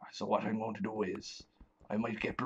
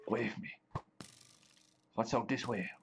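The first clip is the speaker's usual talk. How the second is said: ordinary talk, nothing marked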